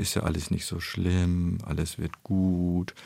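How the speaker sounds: drawn-out soothing words, slowing to the end; comforting. Retold or imitated. Expert imitating someone consoling a fallen child, drawn-out and soothing. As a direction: stretch the vowels to console gently. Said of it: "ein kleines Kind trösten".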